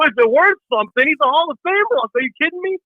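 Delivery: high, incredulous mock outrage; disbelief. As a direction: nephew scoffing at his uncle about the hat.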